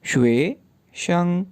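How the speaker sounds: शुए शंग stretched out very slowly, each syllable apart; slow syllable demo. Shown as a demonstration. Teacher slowing शुए शंग down for learners. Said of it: "syllables बना लें".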